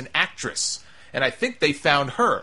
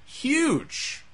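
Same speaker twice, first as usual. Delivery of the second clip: excited echo; 11 semitones higher, 4 dB quieter than usual. Real, not acted. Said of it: "She's going to be huge".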